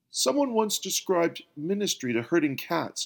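plain reading voice

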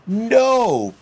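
a big high booming shout; loud unified refusal. A narrator's reading. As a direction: boom it out in protest. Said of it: "boomed all three at once".

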